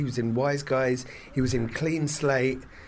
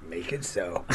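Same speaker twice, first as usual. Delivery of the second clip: breathy mock Picard order; hushed command to the android; whispered command; 8 semitones lower, 7 dB quieter than usual. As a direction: whisper it like a sultry captain.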